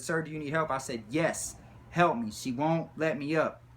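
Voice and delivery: normal talking voice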